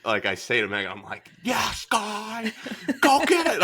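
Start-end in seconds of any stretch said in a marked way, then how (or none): 1.4-3.6 high-pitched, loud fan cheer; root for the hero at the top of your voice; amped-up cheer. Retold or imitated. Amped-up husband cheering the film in front of his wife, high excited yell. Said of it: "I'm all amped up right now".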